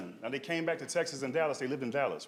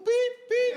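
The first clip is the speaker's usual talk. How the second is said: high squeaky beep beep; scanner beeps; 7 dB louder than usual. Retold or imitated. Lady scanning fruit, squeaky imitated scanner beeps. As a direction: mimic a grocery scanner.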